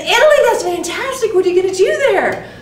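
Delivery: Italy? pops way up, delighted and a bit fast; thrilled Italy. Retold or imitated. Ellen cheers her friend's big news, excited. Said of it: "She said".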